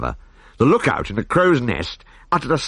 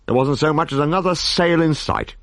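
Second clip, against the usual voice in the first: normal talking voice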